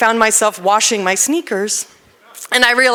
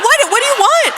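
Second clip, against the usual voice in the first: very high, louder, laughing plea; exasperated plea; 5 dB louder than usual. Retold or imitated. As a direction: throw up your hands, voice cracking high with a laugh. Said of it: "And so I'm, like".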